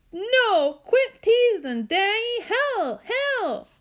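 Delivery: put-on high, loud wail of a stuck boy; panicked boy. Stuck Byron begs Daddy to stop, wailing.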